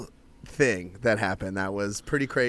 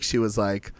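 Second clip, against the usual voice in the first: ordinary talk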